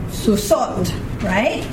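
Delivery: ordinary talk, nothing marked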